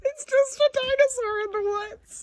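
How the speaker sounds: very high, silly falsetto; playful sky-high pitch; giddy squeal. Pretend. Excited at dinosaur in woods.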